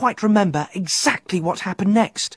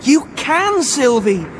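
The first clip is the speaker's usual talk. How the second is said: insisting; 7 semitones higher, 4 dB louder, slower than usual. Acted. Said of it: "Fergus insisted".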